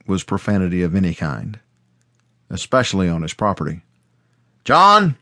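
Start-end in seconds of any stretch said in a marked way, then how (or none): 4.6-5.2 sharp shouted name, high and loud; angry yell. A narrator's reading. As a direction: bark the boy's name in fury. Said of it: "my father yelled".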